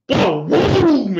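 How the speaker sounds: loud, high imitated bang; booming echo. Retelling the throw hitting the wall, loud booms. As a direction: mimic the ball crashing into the wall.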